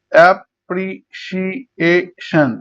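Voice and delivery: Appreciation slowly in five beats; syllable by syllable. Shown as a demonstration. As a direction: separate ap, pri, shi, e, shon in appreciation. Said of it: "পাঁচবার উচ্চারণ হচ্ছে".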